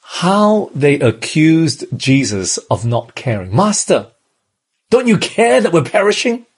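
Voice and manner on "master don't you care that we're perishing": high, desperate cry of frightened disciples; a touch of frightened disciple, voice climbing high; panicked plea. Acted. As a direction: beg over the storm as if sinking. Disciples crying out in storm.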